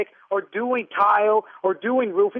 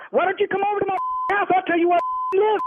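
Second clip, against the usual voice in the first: real anger, high and quick; riled up, pitched high and rushing; angry challenge; 7 semitones higher, faster than usual. Real, not acted. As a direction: throw down a furious challenge. Angry threat to prank caller.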